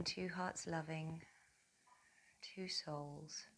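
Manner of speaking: soft, unhurried meditation voice with long pauses; guided meditation. Acted. Yoga teacher connecting mother and baby through breath, soft guided meditation. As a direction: guide them gently through each breath, softly.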